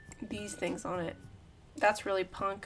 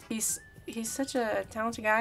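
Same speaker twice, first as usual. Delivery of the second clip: usual speaking voice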